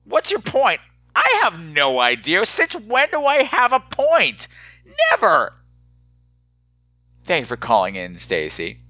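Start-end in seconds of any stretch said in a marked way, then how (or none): 0.0-5.5 mock self argument. Mock arguing with himself.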